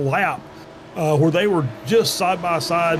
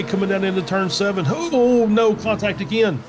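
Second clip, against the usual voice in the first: rising pitch, call building to contact; motorsport commentator; 5 semitones higher than usual. Real, not acted. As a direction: get higher as the battle tightens. Live race play-by-play, building.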